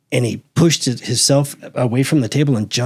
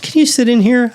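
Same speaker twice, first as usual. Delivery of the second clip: high, louder polite ask; polite request; 11 semitones higher, 4 dB louder than usual. Retold or imitated. Asking the lieutenant to sit in, high and sweet. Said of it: "And I said".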